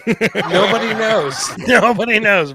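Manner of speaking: laughing through the words in disbelief; cracking up as you answer; loud amused laugh-talk. Real, not acted. Laughing reply to the question.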